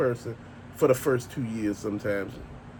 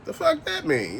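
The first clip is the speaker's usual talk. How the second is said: an outraged, squeaky what-does-that-mean; high, incredulous retort; 13 semitones higher than usual. Retold or imitated. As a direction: pitch jumps way up in disbelief. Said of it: "I'm like".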